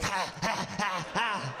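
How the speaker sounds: short, harsh repeated bursts; hammed-up snarling huffs; imitated barking pant. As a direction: mimic an aggressive dog straining at the leash. Imitating aggressive kids on leashes.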